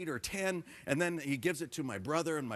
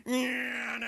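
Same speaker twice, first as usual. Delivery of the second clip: motorcycle engine noise; 7 semitones higher than usual. Retold or imitated. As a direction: imitate a motorbike screaming away. Imitates motorcycle engine pulling away.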